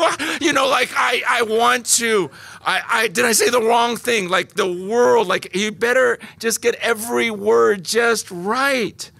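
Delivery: high, hammed-up nervous stammer; mock anxious. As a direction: fret over every word, half-joking. Anxious imitation continues, high.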